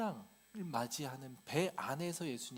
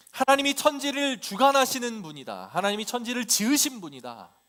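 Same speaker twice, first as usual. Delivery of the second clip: loud, high ringing proclamation; 8 semitones higher, 13 dB louder than usual. Acted. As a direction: proclaim it forcefully to the whole congregation. Preacher proclaims truth about God. (우렁차고 높게 선포하듯)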